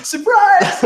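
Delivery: spring it like a sarcastic party reveal; mock surprise. Teasing the listeners about the unhappy ending, mock-cheery shout.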